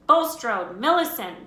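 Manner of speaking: name called across the hall; calling out a name. A narrator's reading. Teacher calling out names.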